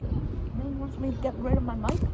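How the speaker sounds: ordinary delivery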